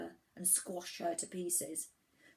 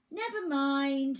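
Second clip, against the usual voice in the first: high, slow, loud lament; grand theatrical sigh of regret, pitched high; mock-sad island voice; 7 semitones higher, 8 dB louder, slower than usual. A narrator's reading. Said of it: "she said sadly".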